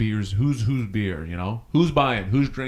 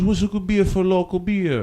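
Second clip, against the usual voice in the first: put-on higher voice, loosely improvised Swedish accent; made-up Swedish accent; 7 semitones higher than usual. Swedish tourist asks about local beer, improvised Swedish accent. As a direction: imitate a Swedish tourist, accent only roughly attempted. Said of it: "so it's just gonna be this thing".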